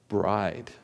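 normal talking voice

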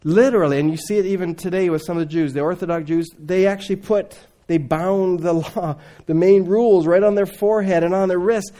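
ordinary talk

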